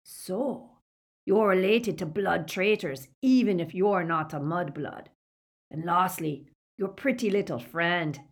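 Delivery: villain savouring each threat; a touch of predatory pleasure; relish. A narrator's reading. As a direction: gloat with mild, menacing relish. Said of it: "The relish in his voice".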